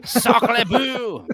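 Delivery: high mock French exclamation; French chef. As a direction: toss it off like a French cliche. Mocking the French stereotype.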